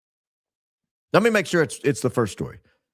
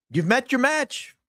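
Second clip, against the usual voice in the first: slow, higher, gloating; gloating taunt; 10 semitones higher, slower than usual. Acted. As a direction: savour the taunt slowly. Repeats taunt, drawn out.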